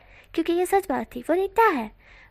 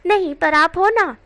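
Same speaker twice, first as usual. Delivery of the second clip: innocently, louder and slow; 4 semitones higher, 10 dB louder than usual. A narrator's reading. Teen boy innocently tells uncle he has one, louder. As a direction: play it wide-eyed and guileless. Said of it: "वो बहुत मासूमियत से बोला था".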